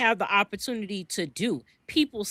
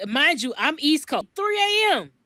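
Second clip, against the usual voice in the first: excited; 6 semitones higher than usual. Real, not acted. Host tells viewers about the chat, excited. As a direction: pitch jumps way up, lively and amused.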